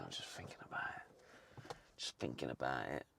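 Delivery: soft unvoiced whisper, thinking aloud; whispered to himself. Real, not acted. Streamer deciding whether to go to Tim's, whispered musing. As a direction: breathe the words to yourself. Muttering to himself about going out.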